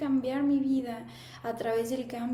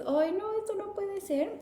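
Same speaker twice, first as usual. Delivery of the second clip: dismayed; 8 semitones higher than usual. Retold or imitated. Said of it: "te enfrentas a la realidad de tu vida y dices".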